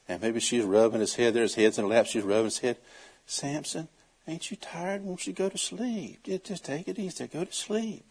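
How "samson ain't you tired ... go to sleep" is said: hushed, softer lulling voice; coaxing softly. Retold or imitated. Delilah coaxes Samson to sleep, a soft lulling voice. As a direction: lull him off to sleep.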